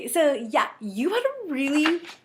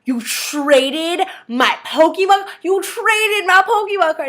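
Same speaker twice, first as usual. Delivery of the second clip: mock outrage; 4 semitones higher, 5 dB louder, slower than usual. Brother upset over traded card.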